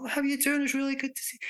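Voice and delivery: high-pitched, gushing greeting to a friend; voice pitch going up. Acted. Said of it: "Your voice pitch may go up".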